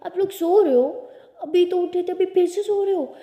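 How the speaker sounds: put-on high, loud little-kid voice; little brother. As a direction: pipe up like a puzzled small boy. Little brother voiced, walking in on them.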